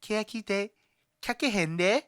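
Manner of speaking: high Kansai Ben title, Kakehen de; Osaka-style intro. Retold or imitated. Imitating the Osaka version intro of Keyakite Kakenai, high. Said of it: "It's like".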